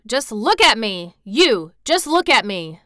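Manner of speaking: loud, defiant, insistent; proud, forceful demand; flung-out challenge. A narrator's reading. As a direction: hurl it at the doubter. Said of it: "he flung up at Ben Weatherstaff".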